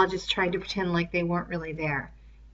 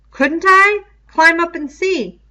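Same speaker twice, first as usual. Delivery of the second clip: put-on taunting boy; 10 semitones higher, 13 dB louder than usual. A narrator's reading. Robert taunts Roger.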